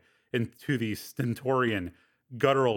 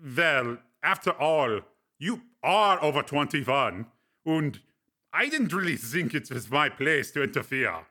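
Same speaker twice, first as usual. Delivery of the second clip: higher, amused friend's voice; Breck, teasing friend; 3 semitones higher than usual. Acted. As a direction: needle him with dry amusement. Breck shrugging.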